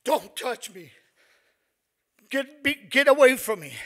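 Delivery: loud, high panicked yell. Retold or imitated. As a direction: shout to keep them away. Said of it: "I yelled at them".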